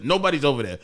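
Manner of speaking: normal talking voice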